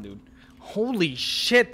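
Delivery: normal talking voice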